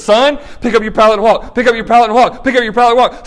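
Jesus to the paralytic, loud command. Acted. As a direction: build Jesus' command with force. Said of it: "And Jesus goes".

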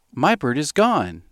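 slow, sing-song storybook reading; slow nursery-poem reading; reading a poem to a baby. Acted. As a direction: read it gently to the baby.

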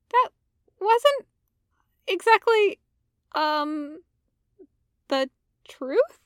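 high hesitant confession; sheepish Ahsoka. Acted. Ahsoka to Anakin, high hesitant confession. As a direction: admit it haltingly.

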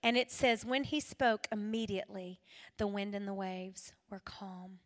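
normal talking voice

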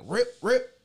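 loud, high ripping noise; imitated ripping. Storyteller showing covers being snatched off, loud ripping sound. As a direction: rip it out sharply, high and loud.

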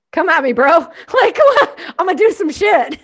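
hammed-up taunting challenge; mock tough guy. Boss taunting staff about office rules, mock bravado.

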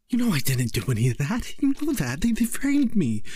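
high, fast, pleading shout; a framed son shouting his innocence to his mother; desperate shout. A narrator's reading. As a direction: shout it back at her in protest. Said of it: "he shouted back at her".

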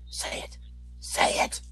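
urgent hushed shouting at the screen; whisper yelling. Retold or imitated. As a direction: hiss it at the screen, urging. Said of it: "whisper yelling at the screen".

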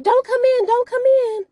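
high, loud shriek to keep someone out; hammed-up high-pitched warning; acted panicked cry. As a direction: mimic a panicked squeal through a door. Acted panicked call through the door.

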